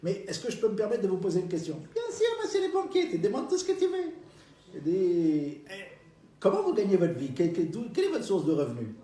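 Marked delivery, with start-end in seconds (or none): 1.9-4.1 put-on old lady voice. Old lady answers banker.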